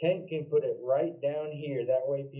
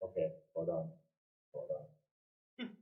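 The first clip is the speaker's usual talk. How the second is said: trailing off flustered; quiet; 9 semitones lower, 8 dB quieter, slower than usual. Real, not acted. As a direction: drop to a soft mutter. Flustered, losing his place.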